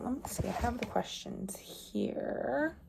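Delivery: under the breath, searching for something; talking to self. Real, not acted. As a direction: softly think aloud while checking notes. Checking notes aside to self.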